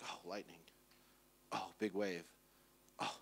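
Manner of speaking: low, hushed little asides; acted quiet unease; mock dread. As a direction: drop to a small, low voice as danger dawns. Acts Peter noticing the storm.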